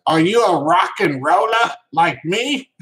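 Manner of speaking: high, loud mock English accent. Streamer reading a viewer's rock and roller question, mock English accent. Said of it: "I can't do the English accent as good as".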